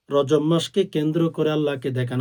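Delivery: normal talking voice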